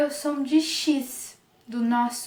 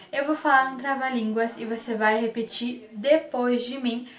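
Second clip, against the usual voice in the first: ordinary talk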